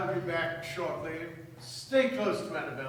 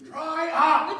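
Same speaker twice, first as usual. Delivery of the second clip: loud, high shout with a laugh in it; laughing shout to silence her; 9 semitones higher, 8 dB louder than usual. Acted. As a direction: yell it half laughing. Men shout the chatterbox down.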